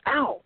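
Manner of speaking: a sharp ow as a rock hits him; pained yelp. Retold or imitated. Friend hit by a rock, imitated high yelp.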